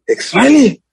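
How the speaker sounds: high, put-on German accent. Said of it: "I was trying to do a German accent".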